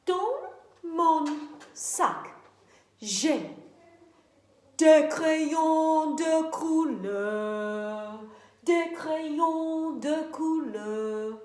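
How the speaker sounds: slow, drawn-out puppet answer. Pretend. Puppet Madame Sou answering the teacher slowly.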